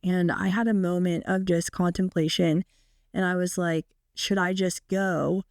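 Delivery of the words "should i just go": ordinary talk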